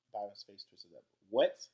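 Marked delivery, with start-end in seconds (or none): 1.3-1.7 sudden loud, higher burst; popped out. A narrator's reading. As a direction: pop it out like a bursting paper bag. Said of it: "voice popped like one of those big brown grocery bags".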